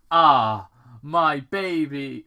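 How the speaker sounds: mock tender. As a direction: dote.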